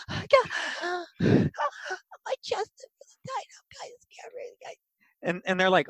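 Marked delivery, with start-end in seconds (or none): 0.1-4.8 breathless goblin panic fading to a whisper; gasp, then shrink to a tiny scared whisper; panicking Boogers. Acted. Goblin confessing fear to friends, breathless panicked whisper. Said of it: "have Boogers have a panic attack".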